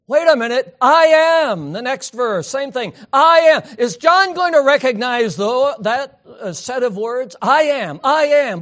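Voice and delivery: loud, high, ringing preacher voice; fervent preaching. Acted. Preacher stirring his congregation, rising and loud. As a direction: build the excitement over the words.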